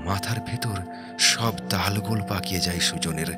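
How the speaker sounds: plain reading voice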